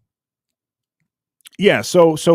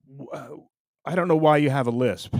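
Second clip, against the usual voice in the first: ordinary talk, nothing marked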